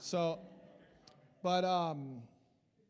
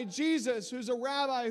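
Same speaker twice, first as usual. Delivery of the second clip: usual speaking voice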